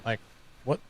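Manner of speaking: a dad's baffled what's-going-on; puzzled father pitching up at the mess; mock incredulous dad. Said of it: "it's more like".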